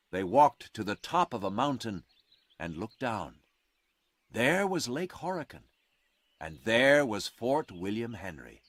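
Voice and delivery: ordinary delivery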